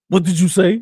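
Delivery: loud, sharp demand in an acted face-off; confrontational challenge. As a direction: play someone calling out an online talker.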